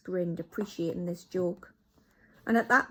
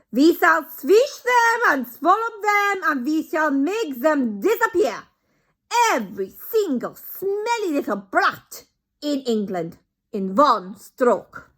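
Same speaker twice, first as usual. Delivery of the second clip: put-on much higher, loud, slow accented gloating; gloating Grand High Witch; 9 semitones higher, 12 dB louder, slower than usual. Grand High Witch promises to destroy children, loud gloat. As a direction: relish every word.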